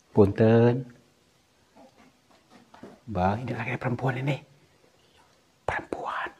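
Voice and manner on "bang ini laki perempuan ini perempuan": soft whispered aside to a companion; voiceless, much softer; hushed whisper. Real, not acted. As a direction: whisper so the spirit won't hear. Hushed aside to companion near ghost.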